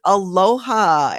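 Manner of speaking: ordinary delivery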